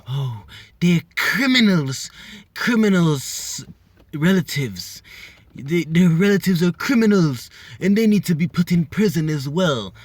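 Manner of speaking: loud, high, hammed-up condemning; mock outrage. Wife condemning others' relatives as criminals, mock indignant. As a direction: cry out the accusation, drawing out key words. Mimics woman's past accusations, mockingly.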